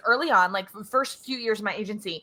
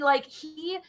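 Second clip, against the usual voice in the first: usual speaking voice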